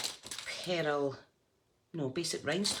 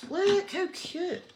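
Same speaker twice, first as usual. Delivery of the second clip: high, slow, louder squeal of delight; delighted cooing; 5 dB louder, slower than usual. Real, not acted. Crafter showing viewers her decoration, delighted cooing. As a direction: coo it high and drawn out over something adorable.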